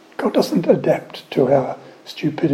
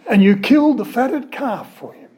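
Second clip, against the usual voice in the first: indignant son; 6 semitones higher, 5 dB louder than usual. Acted. Elder son accuses father.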